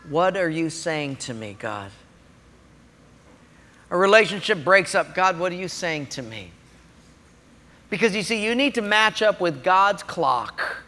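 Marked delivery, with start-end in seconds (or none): 0.1-1.9 low, soft, humble question; reverent, dropping softer; hushed prayer. Acted. Models humble question to God. 5.1-6.5 soft, low, humble question; subdued and listening; hushed prayer. Acted. Models humble question to God.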